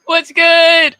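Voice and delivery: loud, high, bright hello with a laugh; excited greeting. Real, not acted. Guest greeting the radio host, excited and loud. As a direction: burst into the greeting like you're thrilled to be back.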